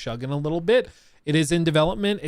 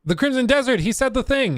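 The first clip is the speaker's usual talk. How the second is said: pitched up, louder, thrilled; excited outburst; 12 semitones higher, 6 dB louder than usual. Real, not acted. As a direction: blurt it out like you caught them saying it. Hears the title mentioned in video.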